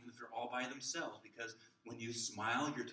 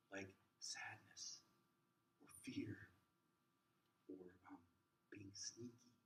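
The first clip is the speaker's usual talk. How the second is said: soft whisper; 4 semitones lower, 13 dB quieter than usual. Shown as a demonstration. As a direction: whisper it like a secret. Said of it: "Sometimes speaking softly".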